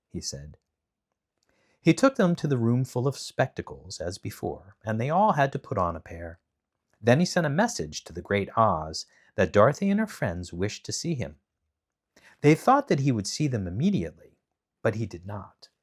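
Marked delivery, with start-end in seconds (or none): none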